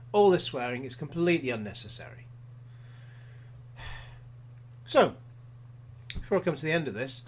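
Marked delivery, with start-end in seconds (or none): 2.3-4.8 long, breathy sigh of disapproval; fed-up sigh after a complaint; exasperated sigh. Real, not acted. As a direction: let out a weary sigh. Exasperated at the swearing.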